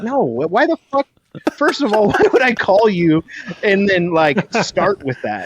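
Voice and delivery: high-pitched, exasperated protest; incredulous retort. Retold or imitated. Telling the vendor she misheard his name, high incredulous retort. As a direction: protest in laughing disbelief. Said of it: "And I'm like".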